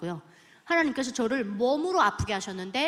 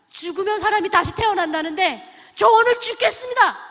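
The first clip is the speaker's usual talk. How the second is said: fervent vow; 7 semitones higher, 8 dB louder than usual. Retold or imitated. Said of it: "서원을 했어요".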